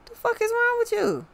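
very high, squeaky mock-incredulous question; mock disbelief. Reader voicing a baffled partner asking what is wrong, high-pitched mock disbelief. Acting out baffled exasperation at the querent.

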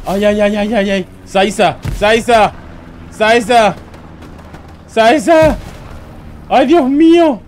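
high, loud repeated calls of Saiza; excited shouting. Real, not acted. Streamer shouting a player's name during a big play. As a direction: cheer a player on at full voice.

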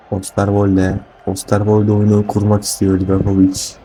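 booming stadium announcer voice; loud announcer. Acted. Announces the second half loudly.

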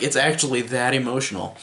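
ordinary talk

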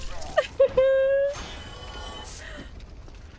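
very high nervous giggle; whimper-laugh in a tiny high squeal; high, frightened tittering; scared squealing laugh. Real, not acted. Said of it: "I'm so scared".

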